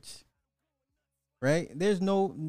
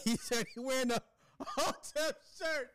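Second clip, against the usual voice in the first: laughing; 11 semitones higher, 5 dB quieter than usual. Real, not acted. Laughing retelling the clip.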